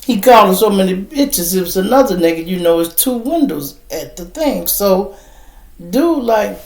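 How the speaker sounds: normal talking voice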